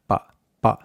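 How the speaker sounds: pa with no puff of air; short, unaspirated pa; muted Spanish p. Shown as a demonstration. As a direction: say pa as the p in spot. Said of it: "more of a muted sound".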